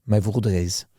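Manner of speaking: mock French accent. Joking to a friend about condom machines, mock French accent. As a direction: tell it as a hammed-up Frenchman. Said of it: "I'm sounding more and more French".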